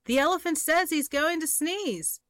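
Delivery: high-pitched shrieking parrot. Acted. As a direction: squawk it out high. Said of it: "With a shriek".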